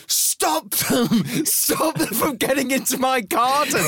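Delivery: hammed-up high wail, laughing through it; mock crying. Farmer begging to keep sheep from garden, mock crying. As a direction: imitate a grown man near tears. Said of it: "like a grown man crying".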